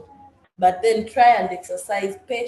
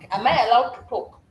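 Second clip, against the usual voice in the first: usual speaking voice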